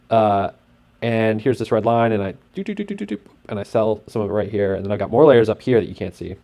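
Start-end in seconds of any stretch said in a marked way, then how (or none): 2.6-3.2 quick run of high, soft "do" sounds; imitated blips. Trader showing layers on the chart, high soft blips. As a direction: patter out high little blips, softly.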